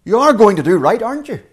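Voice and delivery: you are going to, aren't you, challenging; challenge. Shown as a demonstration. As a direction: push back at God, daring him. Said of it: "or a challenge".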